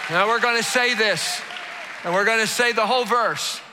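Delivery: shouted high preaching, driving the crowd; preaching at full force. Acted. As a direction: preach it out loud to the whole room.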